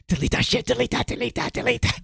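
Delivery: scary angry voice, repeated insistently. Shown as a demonstration. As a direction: order it angrily, over and over. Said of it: "that's my real voice when I'm angry".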